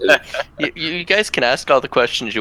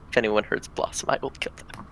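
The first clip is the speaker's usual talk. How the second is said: quiet threat; 10 dB quieter than usual. Acted. Player vows to protect Blossom, quietly threatening. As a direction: drop to a near-whisper for the threat. In-character threat over a pumpkin.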